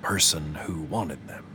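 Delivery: softened to a hushed whisper. A narrator's reading. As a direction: drop to a secretive whisper. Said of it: "Marlon's voice softened to a whisper".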